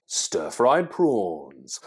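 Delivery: slow, gliding presenter read; voiceover glide. Retold or imitated. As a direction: drawl it like a cooking show voiceover. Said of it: "all the voiceover would be kind of like".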